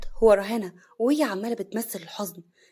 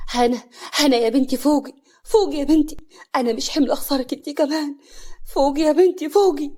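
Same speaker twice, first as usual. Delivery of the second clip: loud, raised urgent pleading; alarmed father; 5 semitones higher, 6 dB louder than usual. A narrator's reading. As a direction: call the fainted daughter back, startled. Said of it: "صالح بخضة". (بخضة)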